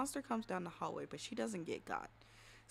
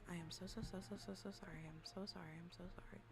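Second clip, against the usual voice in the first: soft, repeated muttered apologies; hushed apology; 2 semitones lower, 8 dB quieter, faster than usual. Real, not acted. Streamer says sorry to chat after a mishap, hushed. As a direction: shrink down into quiet, repetitive apologizing.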